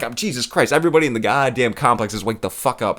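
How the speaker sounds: ordinary talk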